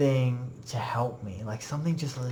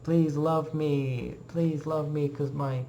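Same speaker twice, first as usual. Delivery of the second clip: needy show-off; 3 semitones higher than usual. Retold or imitated. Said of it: "They wanna be like".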